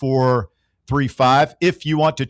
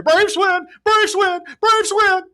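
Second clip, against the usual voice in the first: high, shouted radio home-run call; Skip Carey call; slower than usual. Retold or imitated. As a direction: belt it like a play-by-play man celebrating. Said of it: "right up there with Skip Carey".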